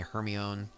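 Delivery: Hermione said HER-mee-own; "Hermione" ending in own, as HER-mee-own; alternative pronunciation. Shown as a demonstration. Listing how people say it, Hermione as HER-mee-own. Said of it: "however you wanna pronounce it".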